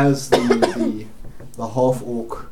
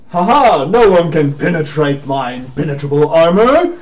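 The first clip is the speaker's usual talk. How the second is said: loud, higher-pitched gloating proclamation; boastful knight; 5 semitones higher, 10 dB louder than usual. Acted. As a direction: crow like an invincible knight in armor. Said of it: "He looks at you and goes".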